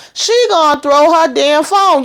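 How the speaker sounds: high, lively recap; rising excitement in the telling; excited. Real, not acted. Excited recap of phone scene.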